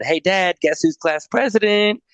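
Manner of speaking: put-on high, eager boyish announcement; proud kid bursting with news; excited kid. Boy proudly telling dad news.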